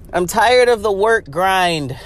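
ordinary talk

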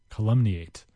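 ordinary delivery

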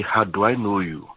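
ordinary talk, nothing marked

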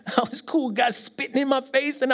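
high laughing boast. Real, not acted. Preacher laughing about staying calm. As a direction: laugh it out, pleased. Laughing at his own victory.

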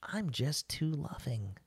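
slow, soft, sweetly self-praising; mock-earnest humility. Parodying a job interview answer, slow mock-earnest humility. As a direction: offer the virtue as if it were a flaw.

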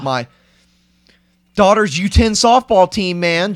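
high, loud, slow worked-up ranting; a sports dad losing it over a kids' game; mock worked-up parent. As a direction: play the over-invested softball parent yelling. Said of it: "And you're like".

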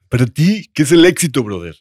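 lightly voiced smug bro; a hint of a cocky gym bro. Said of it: "con voz de mamador".

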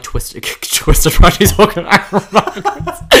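loud, high, slowed by laughter; laughing retelling. Real, not acted. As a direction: laugh through the story.

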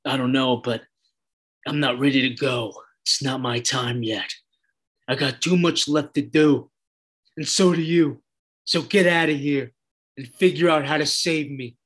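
understated tearful plea in a retold quote; a touch of sorrow, acted out; mildly sad. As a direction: play Hank's sadness lightly, with little tremor. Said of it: "with, uh, tears in his eyes, really sad".

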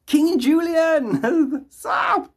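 high, stretched-out squeal of thanks; gleeful drawn-out cheer for a supporter; excited shout-out. Real, not acted. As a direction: draw the name out in a delighted high cry. Greeting a donor on stream.

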